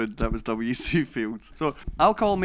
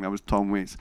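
ordinary talk

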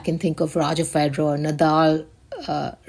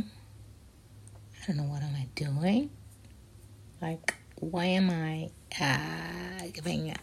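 whispered, sheepish and slow; embarrassed whisper; 13 dB quieter, slower than usual. Real, not acted. Vlogger catching himself cursing on the show, sheepish whisper. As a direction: mumble it under your breath, abashed.

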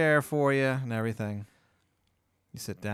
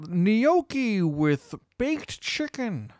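high, very slow waiter announcing the special; 9 semitones higher, much slower than usual. Acted. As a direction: present the dish slowly in a high voice. Waiter voiced in the story.

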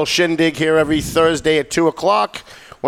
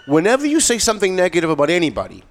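ordinary talk, nothing marked

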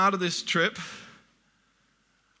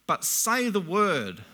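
slow solemn reading; 2 semitones higher, much slower than usual. Acted. Centurion's plea, slowed for weight.

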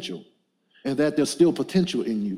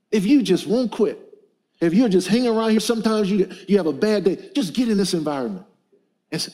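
rising preaching; 4 semitones higher than usual. Acted. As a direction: press on the congregation, climbing. Preaching builds.

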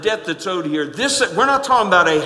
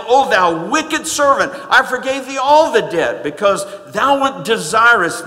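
stern rebuke; 4 semitones higher, 6 dB louder than usual. Acted. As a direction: scold like an angry master. Lord rebukes wicked servant in scripture.

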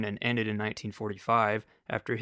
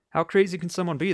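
higher, louder, fast outburst; excited; 7 semitones higher, 5 dB louder, faster than usual. Real, not acted. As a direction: burst out, quick and high.

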